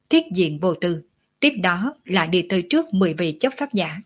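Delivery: usual speaking voice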